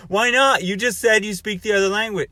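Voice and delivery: insistent stranger pressing the point; indignant stranger. Retold or imitated. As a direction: demand why not. Stranger protests in retold argument.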